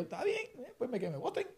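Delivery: high, soft, breezy shrug of a reply; retold unbothered okay. Employee answering the boss's firing threat, high-pitched and unbothered. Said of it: "yo me reí, yo estaba como que".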